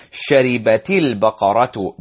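"شربت البقرة" linked as sharibati-l-baqaratu; words joined. Shown as a demonstration. Teacher shows how it's technically pronounced, "شربت البقرة" joined. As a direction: join "شربت البقرة" with an i before the l. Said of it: "joining there".

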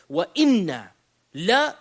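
INNA and LA punched loud, separately; triple emphasis. Shown as a demonstration. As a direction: break it up, hitting "inna" then "la". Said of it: "This triple emphasis".

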